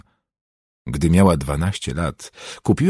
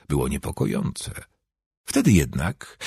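ordinary delivery

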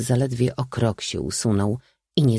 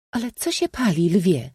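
high-pitched princess's question; princess calling out; 10 semitones higher than usual. A narrator's reading. Princess asking the prince what burns, called out high. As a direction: call it out in a high, feminine voice. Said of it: "zawołała księżniczka Iza".

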